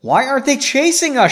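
high, loud, alarmed question; crying out. A narrator's reading. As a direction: cry it out, pitched well up. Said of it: "she cried".

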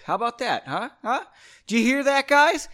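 rising mock challenge shouted at the screen; taunting call-out. Real, not acted. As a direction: call it out loud to mock them. Taunting Microsoft in a rant.

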